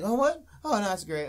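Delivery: high, loud fake surprise; mock surprised. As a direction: play it like a startled girl. Mocking a character's reaction.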